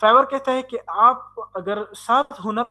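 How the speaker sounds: ordinary talk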